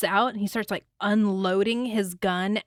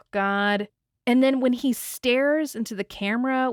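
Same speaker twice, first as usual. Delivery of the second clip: ordinary talk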